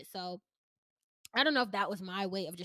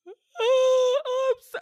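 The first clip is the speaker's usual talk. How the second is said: much higher and louder, voice breaking into a cry; 15 dB louder than usual. Retold or imitated. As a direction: wail it as the tears break. Retelling breaking down to mom.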